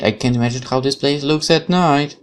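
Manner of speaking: ordinary delivery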